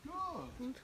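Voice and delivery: high, bright-pitched praise for the dog; sing-song dog praise, pitched high. Real, not acted. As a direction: lift the voice way up to reward him.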